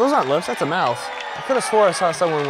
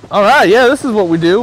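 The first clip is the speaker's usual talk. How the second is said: loud, raised whoop of bravado; excited shout; 5 semitones higher, 10 dB louder than usual. Real, not acted. Skier setting off down the mountain, excited shout. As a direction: shout it like you're showing off. Cheering himself on while skiing.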